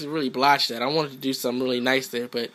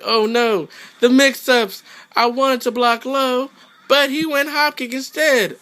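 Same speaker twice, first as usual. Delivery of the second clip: higher, louder whiny dismay; mock panicked opponent; 9 semitones higher, 4 dB louder than usual. Gamer mocking opponent getting mixed up, higher whiny panic. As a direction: whine about the mix-ups like a sore loser. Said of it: "He's like".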